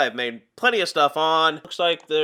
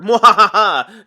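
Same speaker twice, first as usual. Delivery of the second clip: loud slow villain cackle; evil laugh; 5 semitones higher, 12 dB louder, slower than usual. Retold or imitated. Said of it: "That's basically what they do".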